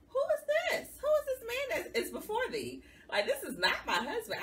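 very high, loud, laughing astonishment; hammed-up shock with an old-fashioned "thee"; mock disbelief. Amazed that her husband backs her, very high laughing disbelief.

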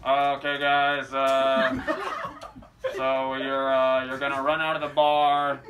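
low, monotone, weary instructions; contemptuous film director. Retold or imitated. Director hating the film instructs extras, low disdainful monotone. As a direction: direct them as if far above this job. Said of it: "he hates it so much".

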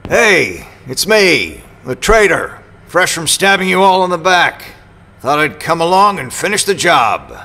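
loud, high, hammed-up self-mocking announcement; mock-dramatic joke. Arriving at the team's camp, mockingly calling himself the traitor, loud and hammed-up. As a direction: announce yourself as the villain, playing it up for a laugh. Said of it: "I'm obviously joking".

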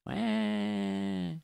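high mock newborn wail; imitating a baby's first cry; baby cry imitation. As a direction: squeal like a crying baby. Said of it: "you hear the little baby cry".

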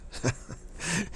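short, soft chuckle; a little laugh to yourself. Real, not acted. Amused after gloomy disappointment remark.

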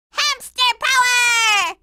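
slow, drawn-out triumphant call; rallying cry. Acted. Girl rallying her hamsters, drawn-out triumphant cry. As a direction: proclaim it like a battle cry, stretched out.

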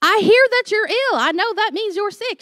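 high, over-careful explaining voice; patient explaining. Retold or imitated. Pastor explaining ill to colleague, high and over-patient. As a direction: spell it out high like to a child. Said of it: "And I said".

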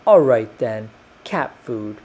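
ordinary delivery, nothing marked

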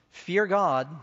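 loud proclaiming call on Fear God, falling off after. Acted. Preacher voicing the angel to his audience, loud call. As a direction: cry it out like the angel's message. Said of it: "Saying with a loud voice".

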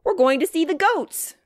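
put-on high, loud, thrilled child; excited shout. A narrator's reading. Grace announces the goats, shouting excitedly. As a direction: call it out with eager delight. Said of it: "shouted Grace".

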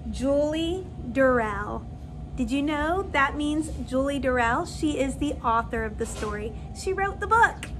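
usual speaking voice

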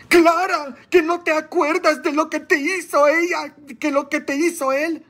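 preacher mimics gossiping friends, high and mocking; mock gossiping women. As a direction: mimic the busybody friends nagging Clara. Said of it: "Ahí andan todas las pedorras".

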